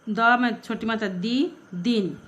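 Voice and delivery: ordinary talk, nothing marked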